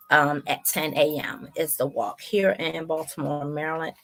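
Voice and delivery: ordinary delivery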